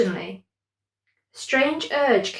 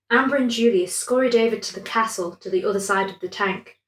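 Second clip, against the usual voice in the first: plain reading voice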